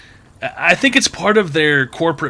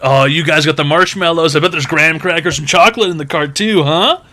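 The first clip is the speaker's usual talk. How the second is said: chatty cashier; 3 semitones higher, 5 dB louder than usual. Retold or imitated. Mimicking cashier, s'mores.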